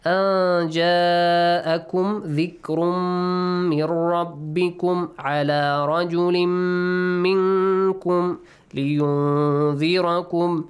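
measured tajweed chant, long held sounds; teacher's sustained recitation; Quran recitation. Shown as a demonstration. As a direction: recite the verse with drawn-out nasals.

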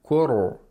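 thick ra held smoothly in "kuru", no bounce; correct tajweed. Shown as a demonstration. Reciter repeats the word as the sheikh says it, thick ra. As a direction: let the r in "kuru" flow on. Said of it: "he pronounces all of them correctly".

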